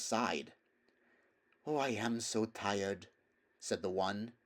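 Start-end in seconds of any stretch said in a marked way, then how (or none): 1.7-3.1 slow, soft weary mare; tired mare. A narrator's reading. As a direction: sigh it out, exhausted. Other horse speaks.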